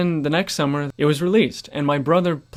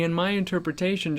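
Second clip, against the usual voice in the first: ordinary talk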